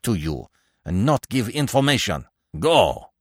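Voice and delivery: high, loud angry outburst; sudden anger. A narrator's reading. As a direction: lash out angrily, driving him away. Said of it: "quite suddenly he became angry".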